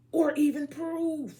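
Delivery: loud emphatic. Real, not acted. Ranting, logic person.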